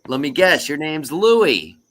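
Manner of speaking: high, loud playful bartender; eager guess. Acted. Bartender guessing the third duck's name, playfully eager. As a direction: build to a drawn-out, confident guess.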